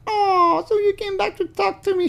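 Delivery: very high, stressed "so" falsetto; high artificial girl voice. Retold or imitated. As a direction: put the weight on "so". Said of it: "Again, very artificial".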